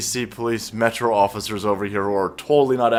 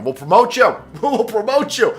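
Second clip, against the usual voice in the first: much higher, louder, laughing disbelief; mocking laughing shout; 10 semitones higher, 5 dB louder than usual. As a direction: crack up and yell the absurd promise. Mock quote bursts out laughing.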